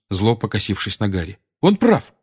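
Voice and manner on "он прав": high-pitched agitated outburst; indignant refusal, pitch held high; heated. A narrator's reading. Seamus refusing to share a room with Harry, heated. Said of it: "разгорячённо сказал ему Симус".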